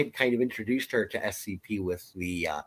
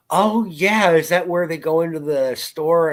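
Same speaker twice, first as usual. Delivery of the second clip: higher, excited retold reply; eager kid, retold; 7 semitones higher, 5 dB louder than usual. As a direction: imitate a kid eagerly piping up. Retelling kid's eager reply.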